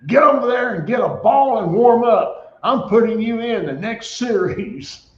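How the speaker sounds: high, loud retold coach's command, Alabama sideline style; retold coach order. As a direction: bark it like an angry coach sending in the backup. Coach barks order at player.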